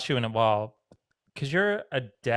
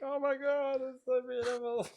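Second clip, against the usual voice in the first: moved gushing; 12 semitones higher, 6 dB quieter than usual. Retold or imitated. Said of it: "and I'm like".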